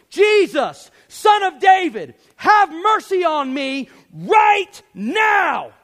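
full-force, high-pitched yell; Baptist preacher shout. Shown as a demonstration. Preacher showing how the blind beggar really said it, full shout. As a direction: shout a demand, stretching the last words out slowly. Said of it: "get all Baptist preacher on you".

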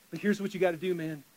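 usual speaking voice

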